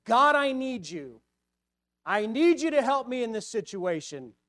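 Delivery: loud, high pleading cry to God; desperate prayer. Acted. Believer crying out to God in need, loud pleading. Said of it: "and you said".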